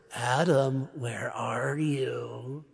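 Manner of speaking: a menacing monster calling out for Adam; big monster. Pretend. God as a monster hunting Adam, slow and menacing. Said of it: "like a big monster".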